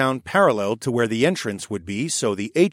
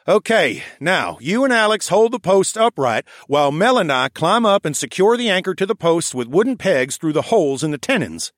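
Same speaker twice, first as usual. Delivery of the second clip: higher bossy raccoon foreman; raccoon architect voice; 6 semitones higher, 5 dB louder than usual. Acted. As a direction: direct the crew like a brisk little foreman. Bowden voiced as a character.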